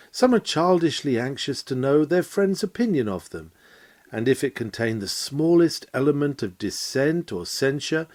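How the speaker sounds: ordinary delivery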